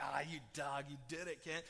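low, lightly voiced "you got me" tease; mock caught-you jab. Congregant teasing the pastor about zooming in, low mock grumble. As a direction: grumble it like a listener who caught the trick. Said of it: "and you're like".

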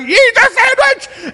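very high, loud, slow laughing-mad order; going crazy. Retold or imitated. Dad losing it at his toddler, slow loud high laughing command. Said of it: "I'm going crazy".

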